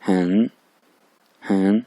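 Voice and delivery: हैन drawn out, dipping then rising; long, scooped हैन; third tone. Shown as a demonstration. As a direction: let the pitch dip and lift through हैन. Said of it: "इस पे third टून है".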